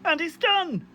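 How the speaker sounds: bright high cry of triumph; excited. Real, not acted. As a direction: celebrate the finished print. Frog print finished, excited.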